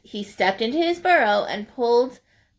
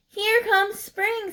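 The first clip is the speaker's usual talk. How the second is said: slow, much higher squirrel voice; sing-song it slowly; 11 semitones higher, slower than usual. Acted. Squirrel announcing spring at party, slow high voice.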